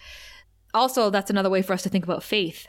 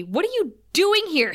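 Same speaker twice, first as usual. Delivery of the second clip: a mock stern snap, pitched up; retelling Buffy's line with a stern edge; 8 semitones higher than usual. Buffy confronting the girl in her room, mock stern. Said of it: "And Buffy says sternly".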